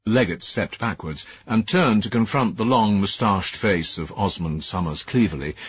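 ordinary delivery, nothing marked

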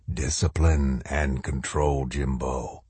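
low, slow, soft menace; shadow soldier. A narrator's reading. Shadow soldier to the colonel, low and stern.

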